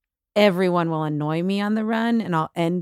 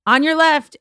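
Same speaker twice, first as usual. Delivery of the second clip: raised voice calling ahead on the trail; called out warning; 6 semitones higher, 5 dB louder than usual. Retold or imitated. Runner warns a walker, loud call. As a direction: call it out to pass her. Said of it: "I yelled at this one girl".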